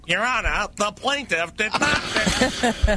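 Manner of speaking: high, slow comic lawyer voice; high, drawn-out mock courtroom plea; courtroom sketch voice. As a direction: ham up the old radio lawyer. Quoting Point of Law sketch.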